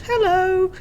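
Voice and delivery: put-on high, sweet girly voice from a burly half-orc; sweet girly hello. Half-orc blacksmith greets the bard in a high girly voice. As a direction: chirp it like a little girl. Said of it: "in an equally sweet, girly voice".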